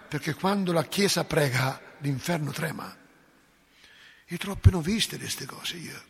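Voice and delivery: ordinary talk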